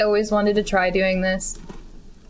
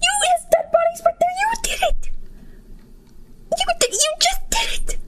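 accusing shout. Real, not acted. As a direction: shriek the blame at the killer. Accusing the NPC of murder.

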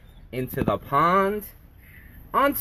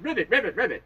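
frog ribbit; 5 semitones higher, faster than usual. Acted. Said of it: "Little Frog said".

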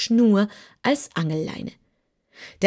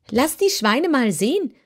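higher, eager kitten voice; 6 semitones higher than usual. Acted. Kitten asks the wizard for the pigs, eager. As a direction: lean in, wanting it badly. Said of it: "sagte Heureka begierig".

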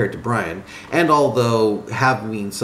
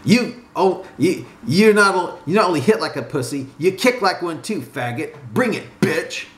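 higher-pitched mocking jeer, no real laughter; amused, goading insults; laughing taunt; 6 semitones higher than usual. A narrator's reading. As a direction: jeer at his feeble attack. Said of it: "got up and laughed, saying".

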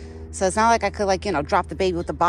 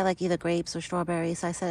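ordinary delivery

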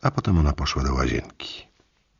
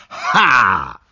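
a loud, higher mock cry of Ha; exclaimed Ha; 6 semitones higher, 5 dB louder than usual. Lidia catching him on the street, a loud hammed-up Ha. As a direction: bark out a hammed-up Ha. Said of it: "wykrzyknęła".

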